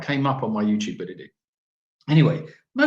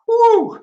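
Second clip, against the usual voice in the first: high, loud "woo"; whoop; 10 dB louder than usual. Real, not acted. Joking to Jan about the smell, loud whoop. Relieved whoop after teasing.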